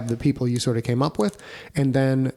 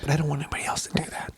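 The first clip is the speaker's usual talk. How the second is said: whisper; 2 semitones higher, 7 dB quieter, much faster than usual. Real, not acted. Quick joking aside.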